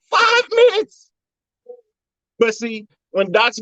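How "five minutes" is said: amazed. Real, not acted. Marvelling at quick transformation.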